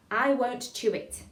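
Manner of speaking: slow, careful diction; tongue-twister demo. Shown as a demonstration. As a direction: say it slowly for a class to copy. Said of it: "Last line".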